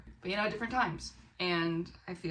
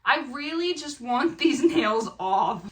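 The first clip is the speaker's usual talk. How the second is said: slow, louder, laughing through the request; laughing plea; 6 semitones higher, 9 dB louder, slower than usual. Retold or imitated. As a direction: plead with a laugh in it. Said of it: "And I was like".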